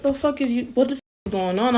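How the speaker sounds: fast, hushed, baffled outburst; disbelief. Retold or imitated. To the friend mocking Africans, fast, stunned disbelief. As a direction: rattle it off in quiet shock. Reacting to prejudiced comments.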